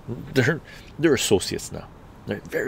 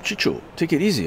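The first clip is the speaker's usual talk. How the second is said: higher, soothing mock partner voice; calming partner; 8 semitones higher than usual. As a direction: settle him down. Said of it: "my partner was like".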